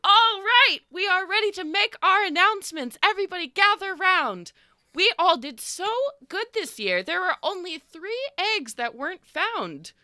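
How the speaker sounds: chirpy festival host calling the crowd in; sugary village host, high and beaming; Lila the host. Acted. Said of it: "and Lila says".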